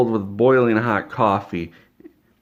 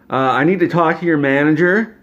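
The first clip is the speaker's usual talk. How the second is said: high, loud entitled demand; loud demanding Karen; 7 semitones higher, 7 dB louder than usual. Retold or imitated. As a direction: call it out sharply, no stammer. Karen demands the manager again.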